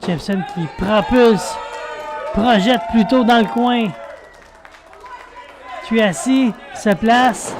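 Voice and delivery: lively play-by-play call; wrestling commentator. Real, not acted. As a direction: call the match live as it unfolds. (commentateur de catch)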